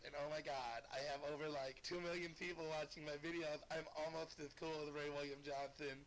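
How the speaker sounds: Shane Dawson impression. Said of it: "I'm gonna do the little chick voice".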